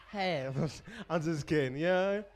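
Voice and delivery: laughing. Real, not acted.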